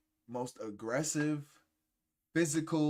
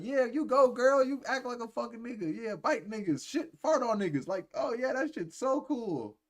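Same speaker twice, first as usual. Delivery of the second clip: high, louder sarcastic cheer; mock cheering fan; 10 semitones higher, 5 dB louder than usual. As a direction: hype her up, dripping with sarcasm. Mocking enablers cheering her on.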